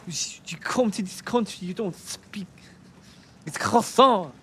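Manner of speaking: mock irate Frenchman. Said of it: "mocking an irate Frenchman".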